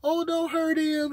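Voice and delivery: high, pleading voice for the poor rabbit; mock pity. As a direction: squeak it out like someone begging for a bunny. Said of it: "You look at the little rabbit like".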